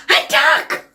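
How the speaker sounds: usual speaking voice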